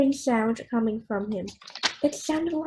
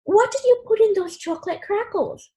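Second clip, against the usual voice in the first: higher, a bit louder accusing screech; 9 semitones higher, 4 dB louder than usual. Acted. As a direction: screech it at your child in alarm. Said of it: "screeched Mom".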